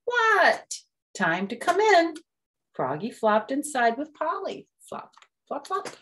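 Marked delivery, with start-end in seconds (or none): 0.1-2.1 loud call back; high shouted reply. Acted. Call and answer. 4.8-5.9 whispered flops. A narrator's reading. Sound effect.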